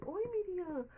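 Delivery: put-on excited girl. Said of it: "A mãe dela veio".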